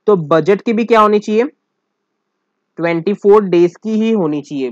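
said out loud with force to drive the point home; emphatic teacher. Shown as a demonstration. Teacher to class, stressing budget must be twenty-four days: firm and loud. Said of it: "teacher drilling the answer aloud".

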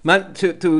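ordinary talk, nothing marked

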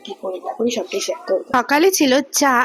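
ordinary talk, nothing marked

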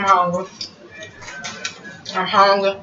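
chewing while talking; mouth full. Real, not acted. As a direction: talk through a mouthful. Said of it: "I'm eating like a savage".

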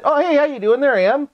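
high-pitched playful greeting; playful pet talk. Real, not acted. Greeting a pet and asking for a lick, high playful pet talk. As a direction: coo to a pet in a high, teasing voice.

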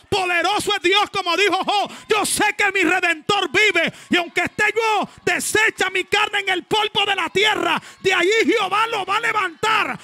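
loud, high preacher's shout; preaching at full force. Real, not acted. A preacher shouting to the congregation in full fervor. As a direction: preach it out at full volume, climbing.

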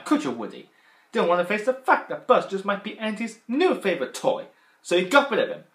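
loud, high accusing toy voice; Mister Potato Head impression. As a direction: corner Woody with loud jeers. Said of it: "he went like this".

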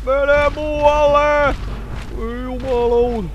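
drawn-out wail, high in the voice; high wailing plea. Real, not acted. Player chased by an enemy, slow high wailing. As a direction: wail each word out long.

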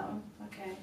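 breathy whispered okay; hushed reply to the voiceover pro. Retold or imitated. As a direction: say it under your breath, taken aback. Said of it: "I was like".

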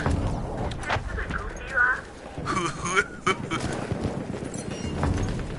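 high silly breathy giggle; a goofy cackling laugh. Real, not acted. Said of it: "I guess I did a goofy laugh".

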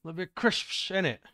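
loud, high British slang; mock British accent. Streamer proving Britishness to co-streamer, exaggerated British accent. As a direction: lay on the caricature British "innit". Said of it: "I'm British now".

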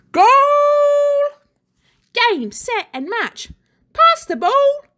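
put-on high, loud kids' calls; playground shouts. A narrator's reading. Children yell mid-game to fool the teacher, loud. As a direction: shout like kids at play. Kids shouting during games.

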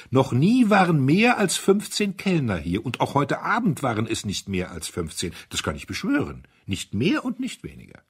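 high loud start settling down; excited insisting, calming. A narrator's reading. Duke swearing the count to the guests, excited. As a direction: swear to it, cooling off. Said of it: "rief der Herzog aufgeregt".

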